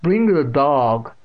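put-on slow, loud deaf old woman; cold repeated order. A narrator's reading. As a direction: repeat it slowly and coldly. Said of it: "Catherine repeated coldly".

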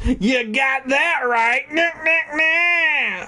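high, slow, hammed-up exclamation; exclaiming. [name] agreeing about his brother in a high exclaim. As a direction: lean into a goofy, high exclaim. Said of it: "I exclaimed".

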